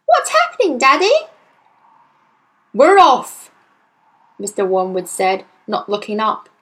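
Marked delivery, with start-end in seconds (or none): 0.1-1.1 put-on high, loud child's question; bewildered little girl, pitched high; alarmed cry. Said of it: "she cried". 2.7-3.5 loud, higher-pitched announcement; curt, forceful dad voice; brusque father. Acted. Harried father packing, barking at daughter.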